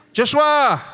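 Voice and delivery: loud, high call across the house; calling out a name. Pretend. Parent calling the sleeping son, loud. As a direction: shout the name to wake him.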